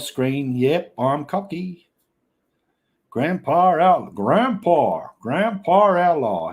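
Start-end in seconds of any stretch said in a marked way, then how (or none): 3.1-6.5 slow, higher, louder mock country drawl; drawled country call. Streamer hailing Grandpa Outlaw, slow loud country drawl. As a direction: stretch it out like a country holler. Playing country, calling out screen name.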